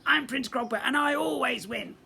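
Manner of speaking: high, loud bratty boast; boastful prince. Acted. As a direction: brag like a spoiled goblin prince. Prince bragging.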